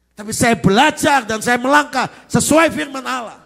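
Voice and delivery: high, very loud rallying shout; full-force preaching. Real, not acted. Preacher rallying the congregation to applause, full-force shouted preaching. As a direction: build to a rousing climax at full volume.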